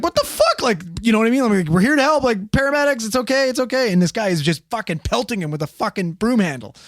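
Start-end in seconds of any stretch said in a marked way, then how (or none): 0.0-0.6 high squeak of disbelief, much higher; shocked cry, pitched way up; shocked yelp. Retold or imitated. Friend being hit with a broom, high shocked yelp. 1.7-3.9 higher, placating reassurance; calm him down, pitched up and gentle; soothing paramedic. Retold or imitated. Paramedic calming the old man, pitched-up reassurance.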